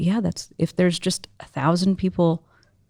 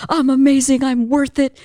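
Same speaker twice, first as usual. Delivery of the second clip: high, louder chant of self-belief; mock self-affirmation; 7 semitones higher, 4 dB louder than usual. Life coach fans psyching themselves up, mock affirmation. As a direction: parody an over-earnest affirmation.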